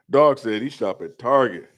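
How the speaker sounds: normal talking voice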